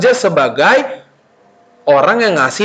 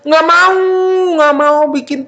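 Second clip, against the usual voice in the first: put-on refusing child; 9 semitones higher than usual. Child refuses to do homework, whining. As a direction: dig in like a stubborn kid. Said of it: "anak-anak tuh suka kayak begini".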